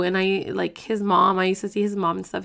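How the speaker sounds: ordinary talk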